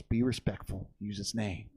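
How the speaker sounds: low, soft instruction; lightly voiced mentor, hushed and low; imitated calm correction. Richard coaching the nervous rider, low correction. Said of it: "And he goes".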